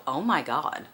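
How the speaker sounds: hushed, low "oh my God"; mock reaction. Reader imitating her reaction to a dark hero, hushed. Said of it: "will make you go".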